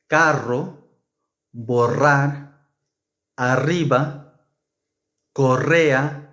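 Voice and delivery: carro, burro, tierra with a trilled rr; rolled r. Shown as a demonstration. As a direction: roll the double r in each word. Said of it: "the strong R must be pronounced".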